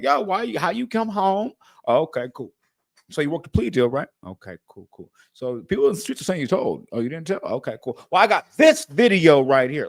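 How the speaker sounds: high, needling gotcha questions; pushy interviewer. Pretend. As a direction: grill him like a gotcha interviewer. Mock grilling a rapper in interview.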